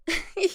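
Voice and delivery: a quick rising giggle; teasing giggle. Acted. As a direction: giggle at his request. Amused at partner's plea.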